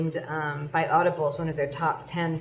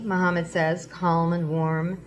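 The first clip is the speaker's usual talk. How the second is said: normal talking voice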